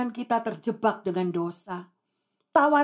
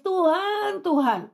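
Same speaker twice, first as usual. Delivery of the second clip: high, pleading call falling away; a retold mother's mild wail to God; imitated tearful cry; 6 semitones higher, slower than usual. Grieving mother calls on God over her dead child, imitated tearful cry. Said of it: "Terus dia nangis".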